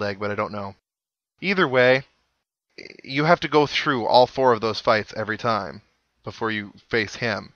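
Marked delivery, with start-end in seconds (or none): none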